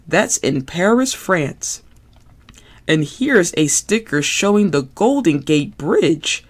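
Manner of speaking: put-on bouncy puppet kid, playful and giggly; Ernie voice. Ernie voice, excited.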